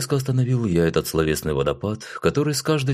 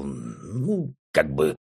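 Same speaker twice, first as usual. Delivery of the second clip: смущённо; 4 semitones lower, much slower than usual. A narrator's reading. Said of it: "смутился я и сумбурно добавил".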